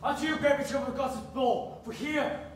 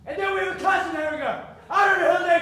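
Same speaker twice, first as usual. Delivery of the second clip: shouting; 5 semitones higher, 5 dB louder, faster than usual. Acted. Father shouts at daughter.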